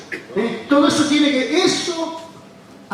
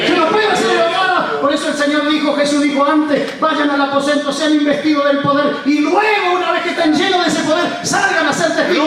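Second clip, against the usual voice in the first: soaring revival preacher at full power; full-force preaching; 8 semitones higher than usual. Acted. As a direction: lift the congregation with a fiery preaching chant.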